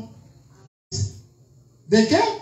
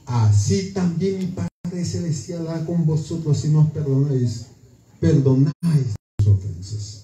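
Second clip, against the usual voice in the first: solemn scripture reading; 7 semitones lower than usual. Acted. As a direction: intone the verse gravely and low. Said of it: "dice aquí ese texto". (lectura solemne del versículo, grave y lenta)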